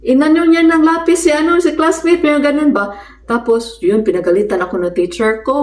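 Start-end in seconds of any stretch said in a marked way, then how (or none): 0.0-3.0 tattling cousin. Retold or imitated. Cousin telling the teacher on [name], high tattling. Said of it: "sabi nung pinsan ko".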